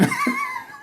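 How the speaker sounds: very high, soft giggle; squeaky laugh. Real, not acted. Host giggling at Mark Chapman's face on the draw. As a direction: giggle helplessly at the memory.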